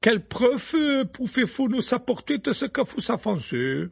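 high, slow haughty demand; disdainful Maxime. A narrator's reading. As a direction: look down on him. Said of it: "avec un geste dédaigneux". (Madame Maxime dédaigneuse)

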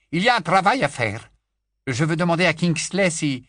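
Lupin's voice. A narrator's reading. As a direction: Lupin laying out a job to Bill.

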